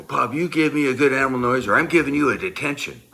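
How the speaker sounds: loud, high, rushed ultimatum; retold teacher yelling a threat; shouted threat. I slam my head.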